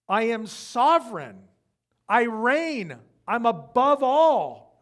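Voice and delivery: slow booming voice of God; megalomaniac rant. Pretend. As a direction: declare sovereignty slowly, thundering.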